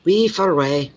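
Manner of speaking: high "We for away" in a Glasgow lilt. Retold or imitated. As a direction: toss it off like Ian. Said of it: "almost like a different language".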